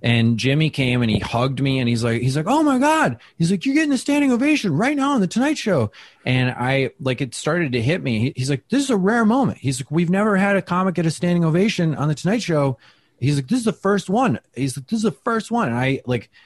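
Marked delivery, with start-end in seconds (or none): 2.5-5.9 high, fast excited voice; imitating Jimmy's delight. Jimmy excited over ovation. 8.7-15.7 high, eager voice; excited Jimmy. Retold or imitated. Jimmy marveling at the moment.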